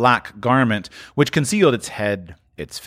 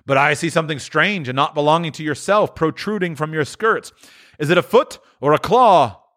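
higher, uneasy question; uneasy Scrooge; 6 semitones higher than usual. Acted. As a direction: ask with growing unease.

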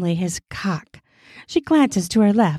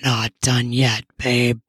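low male voice, hushed; whispered; 7 semitones lower than usual. Acted. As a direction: drop the voice low for the man. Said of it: "he whispers".

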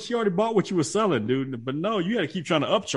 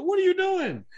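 incredulous; 13 semitones higher, slower than usual. Retold or imitated. Retelling his reaction to wife.

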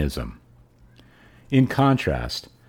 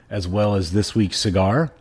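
ordinary delivery

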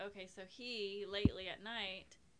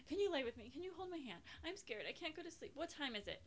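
put-on higher, pleading like a frightened kid; scared child; 5 semitones higher, faster than usual. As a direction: imitate a scared son begging for company. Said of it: "he's crying".

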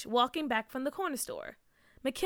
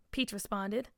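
usual speaking voice

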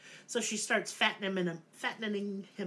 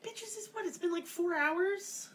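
incredulous scoff; 9 semitones higher than usual. Pretend. Narrator scoffing at Hansel and Gretel for fearing starvation, high and incredulous.

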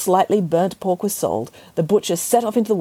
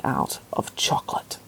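whisper; 6 dB quieter than usual. A narrator's reading. Narration whispered, unvoiced.

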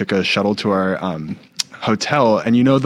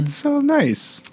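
mock sweet; slower than usual.

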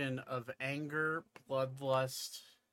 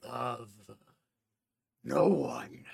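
unearthly voice; 3 semitones lower, slower than usual. Acted. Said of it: "you hear an echoing voice in your head".

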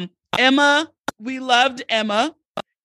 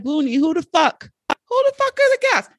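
high, incredulous mock-listener voice, much higher; mock impatient listener; 10 semitones higher than usual. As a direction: play a fan demanding to know the guests. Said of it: "Now you're probably like".

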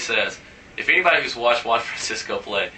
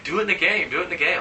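higher-pitched imitated Latin accent; 7 semitones higher than usual. Teammate egging him on, high mock Latin accent. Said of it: "his little Latin accent and stuff".

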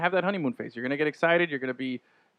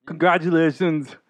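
mock celebrity voice; 3 semitones higher, 6 dB louder than usual. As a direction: ham up a celebrity shout-out. Second mock celebrity clip.

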